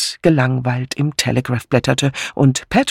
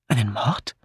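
leise, leicht verwunderte Frage; leicht überrascht; 2 semitones lower, 6 dB quieter than usual. A narrator's reading. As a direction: frage ruhig und etwas erstaunt nach. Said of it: "fragte ihr Mann leicht überrascht". (gedämpft überrascht)

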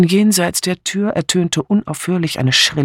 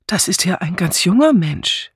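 slightly raised, pitying remark; regretful undertone; 4 semitones higher than usual. A narrator's reading. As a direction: say it with a touch of regret. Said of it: "mit bedauerndem Unterton".

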